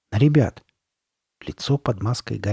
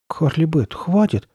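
put-on higher, hesitant boy's plea; uncertain mutter; 6 semitones higher than usual. A narrator's reading. Chuck begging the masked girl to stop, uncertain. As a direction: plead unsurely, pitched up. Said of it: "неуверенным голосом пробормотал Чак".

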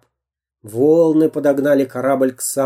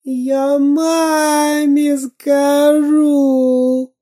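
much higher, very slow tearful wail; high crying wail; 14 semitones higher, 3 dB louder, much slower than usual. A narrator's reading. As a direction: howl in fear. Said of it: "Веня заревел от страха".

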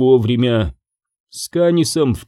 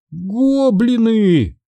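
high, drawn-out squeal; long high-pitched cry; squealed alarm; 9 semitones higher, much slower than usual. A narrator's reading. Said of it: "взвизгнул Свинсон".

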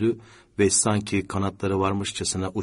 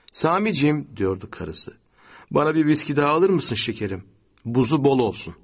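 lightly voiced wife, higher pitch; wife's cajoling request; 6 semitones higher, 4 dB louder than usual. A narrator's reading. Wife asking Sami for another whisky, cajoling. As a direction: sweetly ask your husband a favour.

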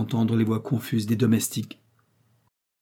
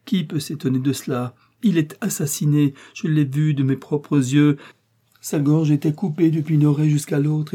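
high, loud, frantic telling; wild and horrified, begging for pity at the end; distraught raving; 5 semitones higher, 3 dB louder than usual. A narrator's reading. Woman raving after a murder.

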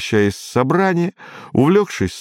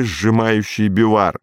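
plain reading voice

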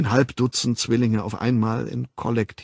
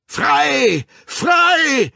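much higher, loud triumphant bellow; roaring; 7 dB louder than usual. Acted. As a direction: roar it out like a liberator. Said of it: "brüllte der Wilde".

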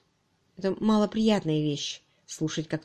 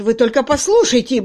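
loud, high indignant outburst; blustering, furious boss; raging; 5 semitones higher, 10 dB louder than usual. A narrator's reading. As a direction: rage in disbelief. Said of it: "бушевал Кирк".

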